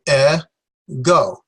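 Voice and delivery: "e", pause, "go"; each syllable of "e, go" sounded apart. Shown as a demonstration. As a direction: break "e, go" into two separate syllables. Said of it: "is the first syllable".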